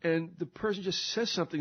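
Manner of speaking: ordinary talk, nothing marked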